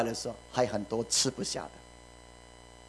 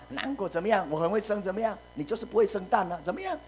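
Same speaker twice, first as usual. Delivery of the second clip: 高声嘲弄对手; mocking rival; 8 semitones higher than usual. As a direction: taunt her like a smug rival. Preacher voices Peninnah taunting Hannah. (模仿嘲讽的语气)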